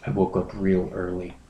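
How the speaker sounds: usual speaking voice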